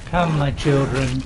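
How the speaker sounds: slow gloating summons; a touch of a dark sorcerer; necromancer voice. Acted. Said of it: "our necromancer voice".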